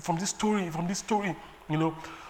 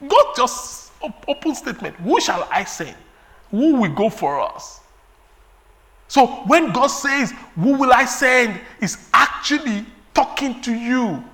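loud, higher preaching voice; preaching at full force; 6 semitones higher, 8 dB louder than usual. Acted. Preacher to congregation, insisting God calls them, loud. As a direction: press it home to the listeners.

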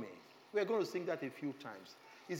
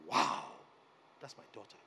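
tender awe; 4 semitones lower, 4 dB louder than usual. Retold or imitated. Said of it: "he's like".

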